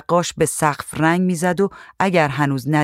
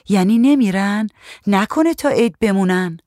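higher, mildly anxious questioning; frightened; 5 semitones higher, 3 dB louder than usual. A narrator's reading. Woman asks painter if roaches will stay, mildly frightened. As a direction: ask with a touch of worry. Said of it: "زن ترسید".

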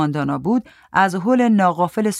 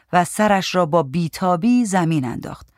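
ordinary delivery, nothing marked

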